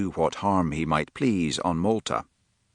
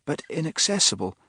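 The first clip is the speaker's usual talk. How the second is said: thoughtful; 8 semitones higher than usual. A narrator's reading. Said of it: "said Philippa thoughtfully".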